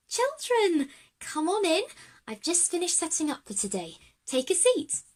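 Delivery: a hint of a bubbly sewing teacher greeting children; cheery teacher. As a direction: welcome the class in brightly, a light teacher voice. Narrator voices teacher Janie.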